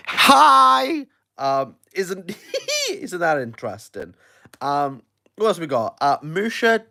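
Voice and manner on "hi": high, drawn-out playful hello; close-up greeting. Pretend. Host demonstrating the live close-up view, playful greeting. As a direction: sing out a hi right up close. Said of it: "put you really close up and go".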